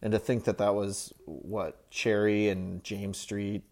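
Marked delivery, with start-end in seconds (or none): none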